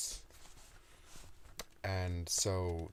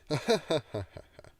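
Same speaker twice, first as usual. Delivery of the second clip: high, loud evil cackle; demon laugh; 10 semitones higher, 8 dB louder than usual. Shown as a demonstration. Author tries another demon laugh, high cackle. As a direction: cackle like a cartoon villain. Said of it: "very bad demon laugh".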